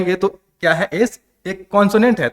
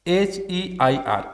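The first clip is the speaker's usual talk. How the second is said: letters spelled out slowly and softly, one by one; 2 semitones higher, 5 dB quieter, much slower than usual. Shown as a demonstration. Teacher spelling the word slowly and softly for students. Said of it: "इसको बोलते हैं एयर".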